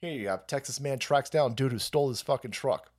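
ordinary talk, nothing marked

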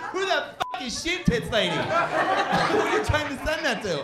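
high, slow mock outrage from the girlfriend; mock furious girlfriend. Girlfriend flipping out over the autocorrected text, high mock fury. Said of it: "she flips out".

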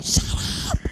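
breathy voiceless snap; hissed shut up. Retold or imitated. Telling the players to shut up again in a voiceless hiss. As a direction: hiss it at them.

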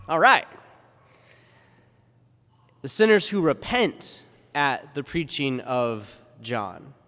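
ordinary talk

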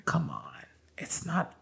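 breathy whispered brush-off; mock dismissive whisper. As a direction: whisper it off as unimportant. Dismissive partner quote.

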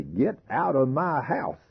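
high, slow scream; screaming at a hog. Shown as a demonstration. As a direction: yell it, broom in hand. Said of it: "start screaming at him".

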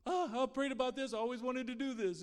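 high, loud, eager burst of joy; excited operator. Retold or imitated. Phone operator telling the children she prayed for this, excited. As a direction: gush like a thrilled phone operator. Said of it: "she was just excited to be a part".

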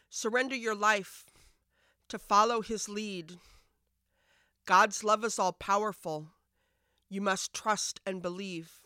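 measured spoken-word reading; poem recitation. Acted. Opens the poem.

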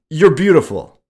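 high and loud, it bursts out; blurted. A narrator's reading. Shy narrator blurting a compliment, high and loud. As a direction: blurt it before you can stop. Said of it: "I blurted out".

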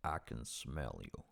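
deep, slow, rumbling Morgan Freeman voice; Morgan Freeman impression. Doing Morgan Freeman for a friend, deep and slow. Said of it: "Unlike me and Morgan Freeman's voice".

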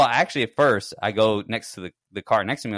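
ordinary talk

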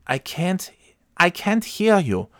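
high, halting imitation; baffled hard-of-hearing woman. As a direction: mimic her puzzled reply in a high voice. Acting the woman who can't hear.

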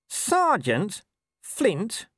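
high, drawn-out squeak of disbelief; little voice. A narrator's reading. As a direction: stretch it out, small and stunned. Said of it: "said Nobby in a little voice".

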